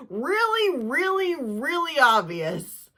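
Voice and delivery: drawn-out "really obvious"; emphatic. Real, not acted. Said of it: "emphatic repetition".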